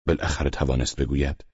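ordinary delivery

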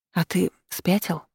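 mildly breathless question; halting question, a touch winded; catching breath. A narrator's reading. Heroine asks demon after the kiss, slightly out of breath. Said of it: "пытаясь продышаться, смогла добавить".

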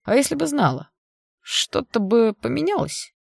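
spiteful sneering question; mocking sneer. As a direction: sneer it with a nasty smirk. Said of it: "со злой усмешкой спросил он".

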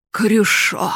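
grave, loud call of a name; solemn voice. Acted. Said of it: "продолжал старик торжественным голосом".

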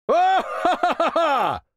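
slow, very high gloating laughter; gleeful high cackle. Acted. King gloats at his cousin's miss, high slow cackle. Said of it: "обрадовался кузен".

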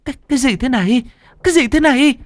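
high-pitched stammering cry of horror; horrified. A narrator's reading. Hằng sees her possessed friend, horrified high cry. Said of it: "Con Hằng trông thấy cảnh này thì kinh hãi".

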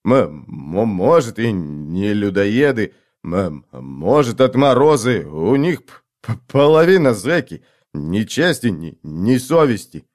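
slow stutter on "может" and "половина"; stammering. A narrator's reading. Stammerer guessing at the killers, "п-, половина" caught in a stutter. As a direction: stumble into "м-, м-, может", halting.

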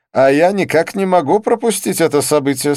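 high, loud, insistent character voice; excitable Didier. A narrator's reading. Didier insists he cannot miss this event, high and excitable. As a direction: push it out high and emphatic, refusing to budge.

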